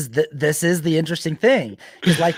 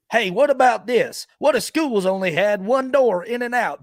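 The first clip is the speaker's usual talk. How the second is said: higher put-on voice of a naive arguer; character voice; 7 semitones higher than usual. Host acts his video's opposition character, higher put-on voice. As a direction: play the opposing character cheerfully. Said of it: "a character who's like".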